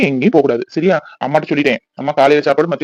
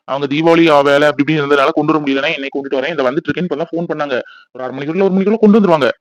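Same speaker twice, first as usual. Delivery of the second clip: ordinary talk, nothing marked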